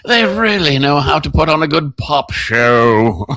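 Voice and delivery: slow, drawn-out fake English accent; hammed-up English pop critic, amused; mock cultured English. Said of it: "feel like I know something about English".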